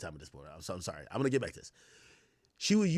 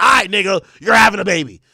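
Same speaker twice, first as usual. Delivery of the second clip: loud, higher retold yell; imitating her loud ultimatum; mock shout; 4 semitones higher, 13 dB louder than usual. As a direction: bark it out like she's fed up. Quoting her angry threat.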